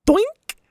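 very high comic blip; tiny high-pitched plop noise; doink sound effect. A narrator's reading. Imitated noise of falling pill.

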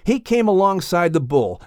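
usual speaking voice

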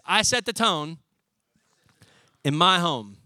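spaced out, each phrase landed firmly; emphatic declaration. Shown as a demonstration. Preacher showing the men how to declare it. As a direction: declare it slowly with a pause before in my home. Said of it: "I want you to say it like this".

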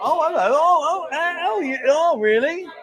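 high-pitched string of playful ohs; hammed-up, swooping surprise; mock surprise. Host hearing the man is in Denmark, mock surprise.

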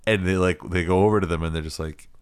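normal talking voice

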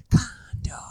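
whispered commercial tagline; velvety seductive murmur. Acted. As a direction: whisper it sexy and mysterious. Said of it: "make me sound sexy and mysterious".

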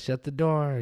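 high imitation of the owner yelling; mock yell. Owner yells at kids over the door, imitated high. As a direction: pitch it up in a playful retold yell. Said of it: "She would yell at us".

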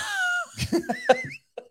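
shrieking laugh up high; high-pitched giggle; high squeal of laughter. Real, not acted. Laughs at own joke.

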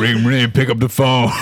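drawled cowboy ringtone voice; sing-song cowboy phone call. Acted.

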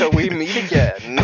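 hammed-up movie villain greeting. Meatloaf voiced as movie villain.

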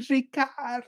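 high, distant-sounding mom's holler, held back in volume; imitated loud call. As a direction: mimic mom calling from far down the aisle. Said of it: "like, loud".

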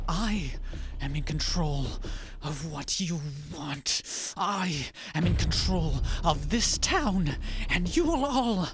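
high, slow, loud tyrant decree; cold rasping evil emperor. Acted. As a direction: proclaim your power over the town. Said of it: "She turns".

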